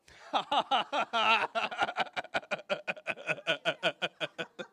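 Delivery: high, loud bursting laughter; laughter cream laugh. Shown as a demonstration. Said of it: "Next is laughter cream".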